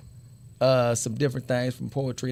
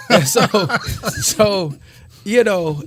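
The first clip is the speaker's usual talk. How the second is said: laughing; 6 semitones higher, 8 dB louder, slower than usual. Real, not acted. Laughs about AI poem reveal.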